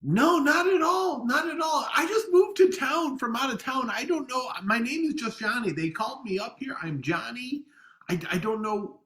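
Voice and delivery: high, fast nervous babbling; panicked protesting delivery guy; flustered panic. Acted. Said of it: "you were really intense there".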